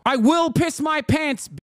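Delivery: put-on high shouted Luton-Gary accent, slowed; mock Luton-Gary accent. Streamer mocks a Tate fan in a Luton-Gary accent. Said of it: "this weird accent like you f- grew up in either Luton or Gary".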